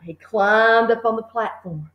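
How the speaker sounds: ordinary delivery, nothing marked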